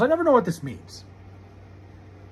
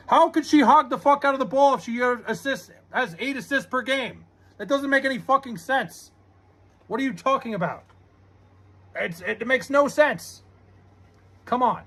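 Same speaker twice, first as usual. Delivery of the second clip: high-pitched indignant protest; incredulous, higher-pitched pushback; exasperated argument; 5 semitones higher than usual. Real, not acted. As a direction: argue back in heated disbelief. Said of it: "Don't yell at me".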